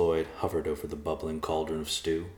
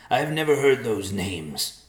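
loud, higher denial; shooting back; 6 semitones higher, 7 dB louder than usual. A narrator's reading. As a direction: throw the denial back at the intruder. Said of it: "Nicholas shot back".

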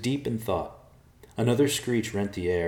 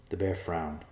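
usual speaking voice

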